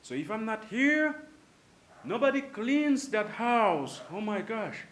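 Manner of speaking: high, loud exasperated mom; scolding mom. Retold or imitated. Mom complaining that nobody else cleans the house, loud and high. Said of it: "if your mom say".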